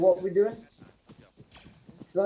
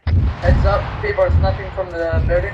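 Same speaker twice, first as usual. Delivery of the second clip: warning shout; 9 dB louder than usual. Real, not acted. Warning about snipers.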